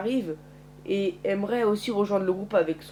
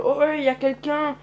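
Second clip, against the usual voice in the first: higher, louder call into a house; yelling out; 8 semitones higher, 5 dB louder than usual. A narrator's reading. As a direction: yell to find out who's there. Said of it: "je hurle".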